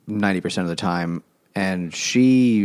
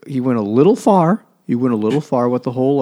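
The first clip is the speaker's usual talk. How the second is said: ironic understatement; 4 semitones higher, 6 dB louder than usual. Real, not acted. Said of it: "ironic understatement".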